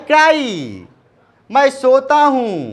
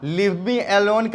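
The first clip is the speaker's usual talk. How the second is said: slow model; 4 dB quieter, much slower than usual. Shown as a demonstration. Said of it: "teacher models phrase slowly".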